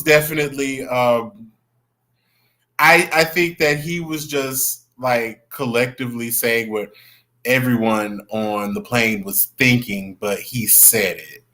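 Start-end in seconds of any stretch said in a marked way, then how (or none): none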